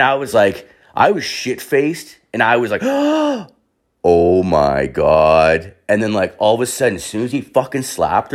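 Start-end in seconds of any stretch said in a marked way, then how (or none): 2.9-3.4 high, sharp gasp of shock. Retold or imitated. Drunk friend reacting to a sudden slap, shocked gasp. 4.0-5.7 very slow, low, drawn-out shock; stunned disbelief. Retold or imitated. Drunk guest watching a husband slap his wife, stunned.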